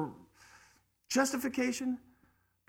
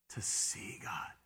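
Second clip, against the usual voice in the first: slow hushed whisper of wonder; awed whisper; 9 semitones lower, 12 dB quieter, slower than usual. Real, not acted. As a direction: breathe it out in awe. Awed hush in sermon.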